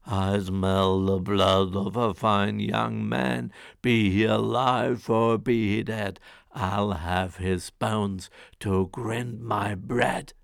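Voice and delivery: low, loud, slow booming giant; a hungry giant rumbling his threat; ogre's cry. Acted. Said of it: "cried out the ogre".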